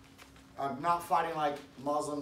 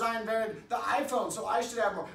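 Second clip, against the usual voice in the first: higher-pitched mock brag; mock boasting; 6 semitones higher, 4 dB louder than usual. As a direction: boast as a rival claiming credit. Second acted boaster.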